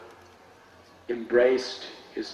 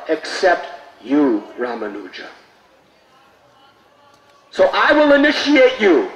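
raised, earnest guru voice; guru's solemn words; 6 semitones higher, 8 dB louder than usual. Acted. Guru solemnly addressing his disciple. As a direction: declare it like a revered teacher.

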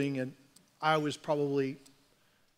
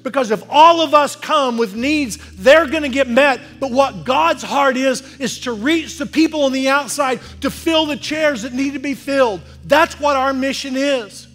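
loud, high, driving preacher's call; full-force preaching; 8 semitones higher, 12 dB louder than usual. Acted. Pastor rousing the congregation to reach outsiders, loud full-force preaching. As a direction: preach it hard to the whole room.

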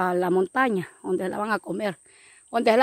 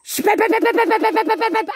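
high, rapid pe pe pe like shots; a child's machine-gun sounds; mock gunfire noises; 9 semitones higher, 4 dB louder than usual. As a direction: spit out the shots like a toy gun. Mock gunfire in soldier play.

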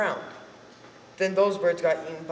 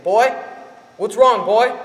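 loud, worried father calling out; father rushing in, calling loudly; 4 semitones higher, 10 dB louder, slower than usual. Acted. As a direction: shout for the boy. Father bursting from the bushes.

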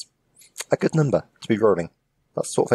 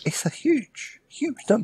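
sarcastic awe; 13 semitones higher than usual. Pretend. Mock awe at tiny percentage.

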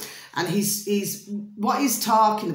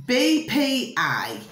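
slow, emphatic B P A, a touch higher; announcing the acronym slowly; spelled-out letters; 6 semitones higher, slower than usual. Shown as a demonstration. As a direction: spell each letter out for the audience.

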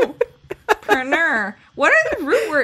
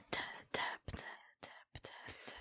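soft unvoiced tap, tap, tap; whispered tapping demo; 27 dB quieter, much faster than usual. Shown as a demonstration. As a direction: breathe the taps out like a gentle tap. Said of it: "like a soft little".

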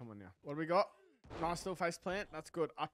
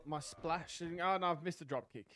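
usual speaking voice

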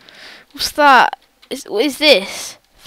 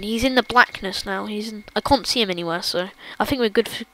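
ordinary delivery

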